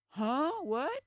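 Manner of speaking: loud, high baffled huh; bewildered crowd reaction, hammed-up; imitating confusion. Everybody else sitting there, loud baffled huh. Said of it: "sitting there like".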